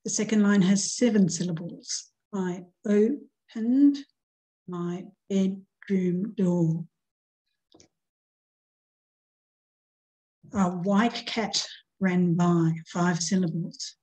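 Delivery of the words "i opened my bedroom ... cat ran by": slow, measured poem reading; slow recital of a short poem. Shown as a demonstration. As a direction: read it out like a poem, unhurried. Reads her example poem aloud.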